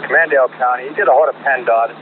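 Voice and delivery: ordinary talk, nothing marked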